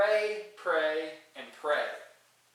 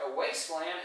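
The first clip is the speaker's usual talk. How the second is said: usual speaking voice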